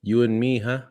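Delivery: hammed-up Filipino accent, climbing in pitch; mock Filipino-accented flirting, huh on the end; Filipino-accented pickup.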